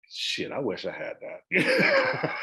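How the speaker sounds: slow, laughing envy. Retold or imitated. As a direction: chuckle through the wish. Retold envious thought, laughing.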